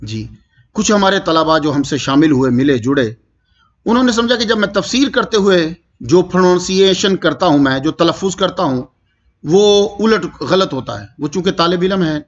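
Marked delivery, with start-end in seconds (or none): none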